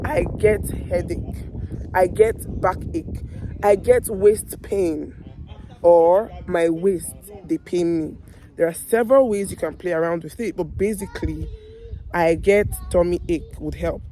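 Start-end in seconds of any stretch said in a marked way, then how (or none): none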